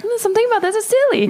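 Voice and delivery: high, playful put-on voice, musing; amused inner voice. Voicing her own brain hearing a strange accent, high and playful. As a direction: pitch it up high, tickled by the oddness. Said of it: "but it's like".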